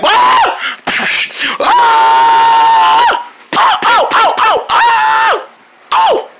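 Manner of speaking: high, loud screams of pain. Real, not acted. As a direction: yell ow in real pain. Hurt in the basement.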